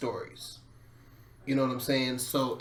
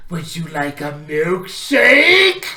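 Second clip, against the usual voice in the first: server voice; 3 semitones higher, 10 dB louder, slower than usual. Retold or imitated. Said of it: "bistro says".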